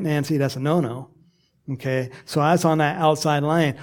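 ordinary talk, nothing marked

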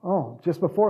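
ordinary talk

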